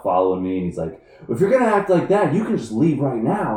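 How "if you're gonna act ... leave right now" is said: loud, high staged outburst; mock-furious manager. Manager to employee in staged firing, loud angry act. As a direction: storm out yelling, putting on a scene. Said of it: "The general manager comes storming out".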